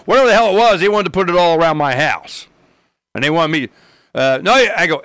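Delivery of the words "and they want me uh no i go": usual speaking voice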